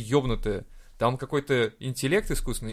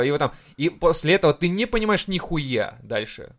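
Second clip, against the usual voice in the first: ordinary talk, nothing marked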